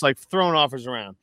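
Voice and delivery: ordinary delivery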